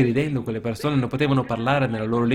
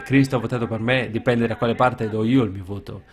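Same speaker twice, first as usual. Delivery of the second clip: usual speaking voice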